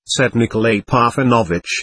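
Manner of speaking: usual speaking voice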